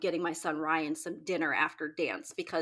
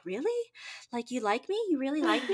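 high, giddy disbelief; disbelieving delight; 6 semitones higher, faster than usual. Retold or imitated. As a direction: squeal it like a flattered fan. Retold reaction to fan praise.

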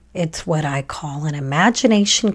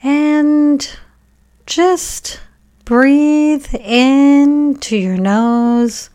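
guided meditation; 2 semitones higher than usual. Acted. Podcast host guiding children to thank heart, brain and body, guided meditation. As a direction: guide children gently through it, leaving room to breathe.

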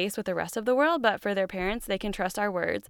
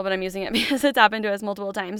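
ordinary talk

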